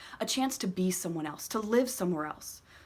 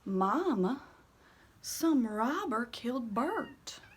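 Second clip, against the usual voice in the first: put-on shaken boy; 4 semitones higher, much slower than usual. Boy reporting shock.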